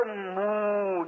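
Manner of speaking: long, slow, low mock moan of wind in the pines; mournful wind moan. Describing the wind in the dead pines to the congregation, mournful moan. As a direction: draw out a ghostly "moo" like wind through trees. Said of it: "a mournful sound".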